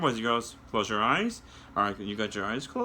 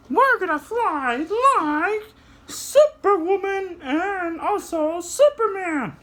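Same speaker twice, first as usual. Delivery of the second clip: very high, slow, louder puppet flying; 5 dB louder, slower than usual. Acted. Puppet shows kids how to fly, very high voice. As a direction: soar up into a tiny high voice.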